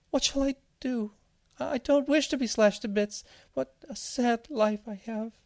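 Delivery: very high tiny whimper; whimpering seed. A narrator's reading. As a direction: wail pitifully as a little seed. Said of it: "the seed whimpered".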